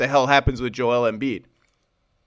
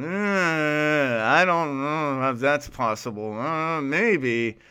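imitating a doubtful, reluctant source, quieter; reluctant insider; 4 dB quieter, much slower than usual. Host imitating a reluctant source on the phone, doubtful and hesitant. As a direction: sigh it out like an unsure insider. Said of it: "that's the sound I hear over the phone".

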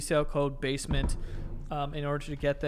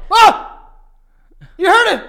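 excited shout; 17 dB louder, slower than usual. Real, not acted. Catching friend admitting wanting cars.

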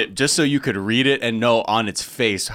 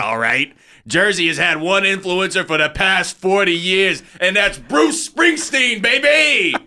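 put-on Jersey guy accent; 3 semitones higher than usual. Comedian imitating the host's Jersey monologue, rising to a yell.

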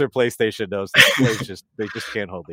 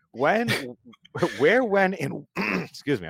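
choking; 7 semitones higher, slower than usual. Real, not acted. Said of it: "I'm choking over here".

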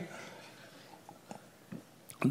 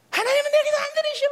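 high-pitched whine of grievance; mock complaining; 11 semitones higher, 3 dB louder than usual. Resentful believer complaining that God ignores them, mock whining. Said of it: "막 이런 말들이 나오는 거죠".

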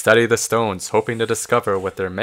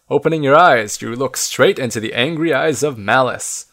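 ordinary delivery

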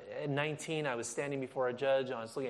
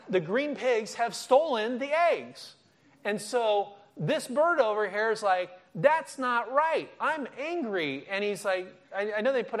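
high, loud mock outrage; hammed-up angry bird; 8 semitones higher, 7 dB louder than usual. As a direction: voice the bird's indignation, slowed. Preacher voicing angry bird.

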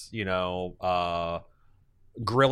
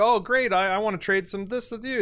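mock eager trader; 11 semitones higher than usual. Acted diplomacy quarrel.

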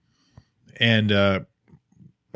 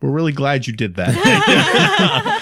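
laughing approval; 4 semitones higher, 4 dB louder, much slower than usual. Retold or imitated. Old ladies thank him.

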